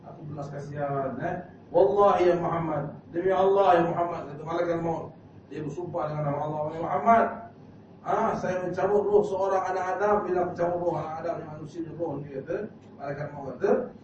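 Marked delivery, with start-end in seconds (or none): 1.7-4.3 loud, slow, solemn oath. Acted. Angel of death swearing to the Prophet, loud and solemn. 6.7-11.3 loud solemn angel. Acted. Angel of death speaks.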